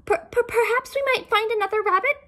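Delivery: high, fast stuttering turtle voice; stammering turtle. Acted. Turtle nervously begging the king, high and stammering. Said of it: "said the turtle".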